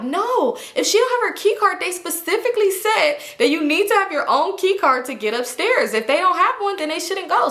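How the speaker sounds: high, insistent rule-quoting; bossy lecture. Retold or imitated. Drunk girl lecturing strangers about key cards, high and bossy. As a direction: lay down the rules, self-important.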